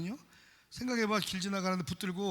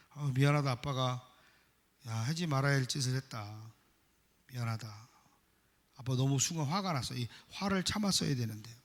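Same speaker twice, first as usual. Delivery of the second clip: lower, softer, heartfelt apology; soft apology to children; 8 semitones lower, 5 dB quieter than usual. Retold or imitated. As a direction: apologize gently to the kids as dad. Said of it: "사과를 했어요". (아이들에게 사과하는 아빠, 낮고 조용하게)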